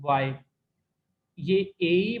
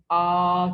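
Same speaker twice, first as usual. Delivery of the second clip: आ held long and straight, louder; first tone, flat; 7 dB louder than usual. Shown as a demonstration. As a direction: sustain आ on one level pitch. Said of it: "first tone के साथ".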